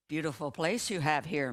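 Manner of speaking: usual speaking voice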